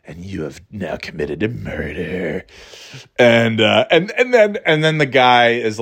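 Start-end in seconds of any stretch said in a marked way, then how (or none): 0.0-2.5 ominous hushed voice. Acted. Ominous voice in the movie.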